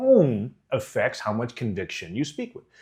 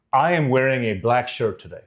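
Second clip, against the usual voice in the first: full conviction; 2 semitones lower than usual. Shown as a demonstration. Coach shows how to sound sure of a fact, full conviction. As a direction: state it as a plain fact. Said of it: "say it with one hundred percent conviction".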